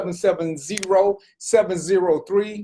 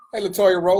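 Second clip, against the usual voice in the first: ordinary talk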